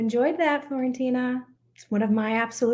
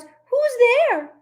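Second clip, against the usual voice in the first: high, louder timid squeak; squeaky mouse; 12 semitones higher, 7 dB louder than usual. Acted. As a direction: cry out like a tiny mouse. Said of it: "Mouse cries".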